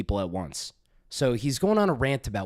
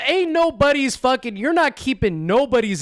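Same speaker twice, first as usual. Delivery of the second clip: outraged outburst; 9 semitones higher than usual. Real, not acted. Streamer bursts out at the ranter's boast, high outrage. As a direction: cut him off in outrage.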